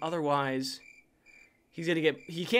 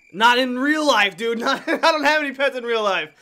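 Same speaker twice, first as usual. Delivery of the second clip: loud, higher, laughing clarification; laughing protest; 5 semitones higher, 9 dB louder than usual. Real, not acted. Streamer hastily corrects his pets joke, loud and laughing. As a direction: blurt a correction through laughter.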